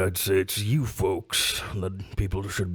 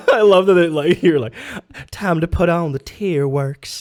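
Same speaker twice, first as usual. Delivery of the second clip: laughing; 9 semitones higher, 9 dB louder than usual. Real, not acted. Laughing at fake crying.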